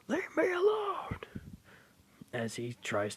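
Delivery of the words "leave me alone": high, loud and furious; shoving away the guardian with a high yell; angry shout. Acted. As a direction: yell it to push him away. Boy shoves away his guardian.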